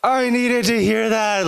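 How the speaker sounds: high, slow, touched voice; mock moved listener. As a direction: play the moved woman, hammed-up and high. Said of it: "she was supposed to say".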